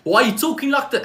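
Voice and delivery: high mock voice of the wife; imitating wife. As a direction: playfully imitate the wife teasing his accent. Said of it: "then she was like".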